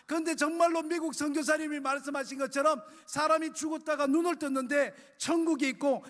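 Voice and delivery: high-pitched mother begging her own mother; desperate tearful pleading. Retold or imitated. Mother begging grandma to take her to church, tearful plea. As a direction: plead through tears, voice climbing and breaking. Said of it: "막 자꾸 난리를 피웠는데".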